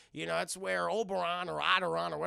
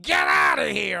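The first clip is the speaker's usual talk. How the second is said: mock angry shout; 7 semitones higher, 15 dB louder than usual. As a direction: yell them out the door. Buyer orders the burglars out.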